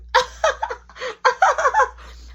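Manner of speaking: high mocking cackle; mocking laugh. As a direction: laugh at someone's excuse. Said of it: "Mark started laughing like".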